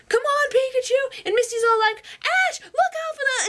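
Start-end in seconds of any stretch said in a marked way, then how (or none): 0.1-1.2 very high, urgent call; Ash. Acted. Fleeing boy calling his Pikachu along, urgent. 2.2-3.5 very high, alarmed warning; Misty. Acted. Girl warning the boy before he crashes, alarmed.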